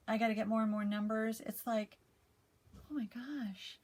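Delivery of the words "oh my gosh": breathless, slow whispered gasp; hushed, amazed whisper; awed whisper. Retold or imitated. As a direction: whisper it in disbelief. Amazed at viewer numbers.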